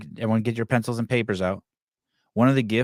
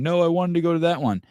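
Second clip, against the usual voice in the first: higher, mild whiny imitation of a son; mock crying kid; 6 semitones higher than usual. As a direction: lightly imitate a child about to cry. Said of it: "like, crying".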